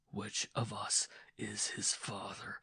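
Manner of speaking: slow hiss through teeth. Acted. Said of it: "he hissed".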